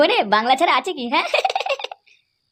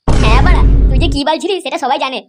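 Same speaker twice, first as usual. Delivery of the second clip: put-on loud drunken shout; 7 dB louder than usual. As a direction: bark it out at your friend. Drunk friends arguing, shouting.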